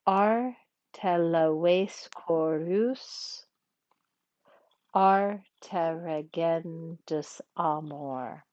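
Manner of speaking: very slow Latin verse with a rest midline; metrical scansion. Shown as a demonstration. Teacher practices the next pentameter example, slow metrical reading. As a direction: scan each foot slowly, pausing at the caesura. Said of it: "we're gonna practice a little bit".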